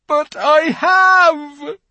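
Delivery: slow, loud, high cry; anguished cry. A narrator's reading. Fawn crying out his guilt to Lucy. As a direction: cry out the admission.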